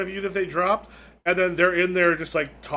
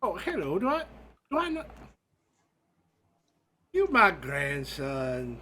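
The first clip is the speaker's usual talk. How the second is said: put-on old woman ghost. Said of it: "you got these two old women".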